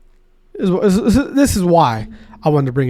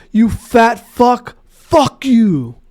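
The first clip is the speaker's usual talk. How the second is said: slow, higher, louder jeering insult; mock heckler insult; 5 semitones higher, 4 dB louder, much slower than usual. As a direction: spit it out slowly like a rude heckler. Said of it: "another guy go".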